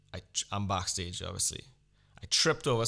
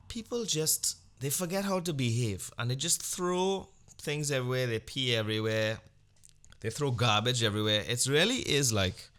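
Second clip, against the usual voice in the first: ordinary talk, nothing marked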